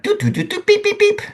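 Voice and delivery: very high sung doot and beep; sung calculator beeps. Retold or imitated. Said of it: "Something like".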